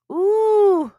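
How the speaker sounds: high, loud delighted ooh; excited little-girl squeal. Acted. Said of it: "Bee squealed".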